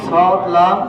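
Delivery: loud, clear সদ, লাম for the class to echo; drilling letter names. Shown as a demonstration. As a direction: call out সদ, লাম for repetition. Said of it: "teacher drills letters loudly". (ক্লাসকে জোরে সদ, লাম বলা)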